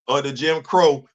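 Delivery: ordinary talk, nothing marked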